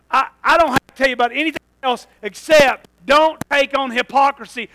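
loud, urgent preacher's warning; full-force preaching. Real, not acted. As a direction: warn the congregation at full voice.

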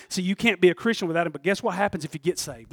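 ordinary talk, nothing marked

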